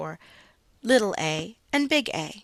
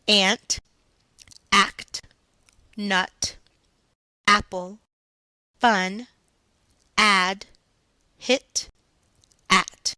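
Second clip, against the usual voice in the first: each word said louder, set apart by pauses; words called out one by one; 5 dB louder, much slower than usual. Shown as a demonstration. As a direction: call out each word clearly for the class. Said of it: "Here are the words".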